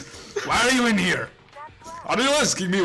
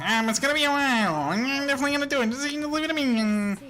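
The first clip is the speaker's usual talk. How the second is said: high, fast Waluigi impression; lightly voiced nasal villain; 9 semitones higher than usual. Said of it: "you just made him sound like Waluigi".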